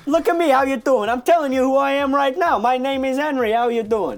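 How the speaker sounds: high-pitched, pushy mock New York accent; Joe Pesci accent. Henry introduces himself to listeners in a put-on New York accent. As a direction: play a cocky New Yorker, accent hammed up. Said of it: "you sound like Joe Pesci".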